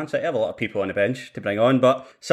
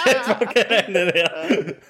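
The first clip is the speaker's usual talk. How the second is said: laughing; 6 semitones higher than usual. Real, not acted.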